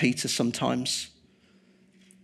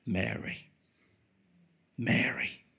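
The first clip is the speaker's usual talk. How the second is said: tender whisper; 4 semitones lower, 8 dB quieter than usual. Retold or imitated. Risen Jesus calls Mary.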